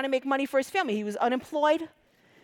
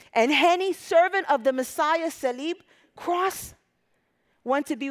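louder, higher, slowing on cross; preaching at full force; 4 semitones higher, 3 dB louder than usual. Acted. Preacher crying out the martyr's name to the church. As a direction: proclaim his name, stretching the last word.

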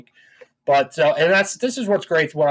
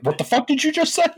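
high, incredulous challenge; mock outraged disbelief; 10 semitones higher than usual. Reacting to an insult behind the back, mock outrage. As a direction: pitch it up, affronted and retold.

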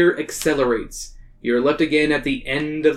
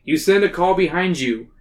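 ordinary delivery

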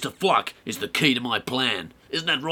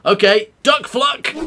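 shouted order; 7 semitones higher, 8 dB louder than usual. Acted. Shouting a warning to duck.

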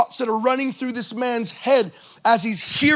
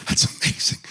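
whispered, softer, in wonder; awed whisper; 6 dB quieter than usual. Shown as a demonstration. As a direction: breathe it out amazed. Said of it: "Can I just demonstrate for you for a moment?".

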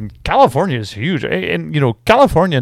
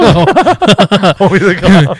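very slow, louder, drawn-out clown-show voice; Homie the Clown; 5 semitones higher, 6 dB louder, much slower than usual. Retold or imitated. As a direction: stretch every word like a TV clown. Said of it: "That was an awesome reference".

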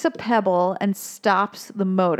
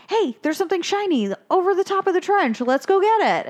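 excited Marlin; 9 semitones higher than usual. Retold or imitated. Marlin spots shiny thing.

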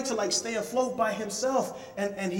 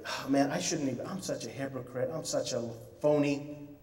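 self-loathing; 6 semitones lower, 7 dB quieter than usual. Retold or imitated. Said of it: "beating myself up".

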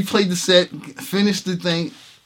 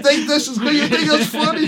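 retold outburst, high and loud; angry defiance; 13 semitones higher, 6 dB louder than usual. Musician retelling his fury at the bandleader, angry defiance. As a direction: pitch it way up in mock outrage.

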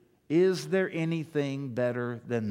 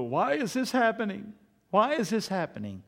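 frustrated whining; 7 semitones higher than usual. Retold or imitated. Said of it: "you look at life and you go".

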